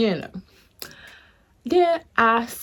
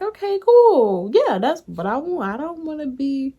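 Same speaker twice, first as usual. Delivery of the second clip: replaying her own answer; 7 semitones higher than usual. Retelling how she answered the lady, higher. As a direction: brightly act along, higher.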